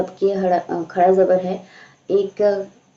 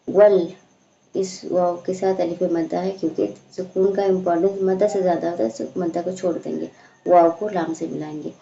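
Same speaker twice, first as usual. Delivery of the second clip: usual speaking voice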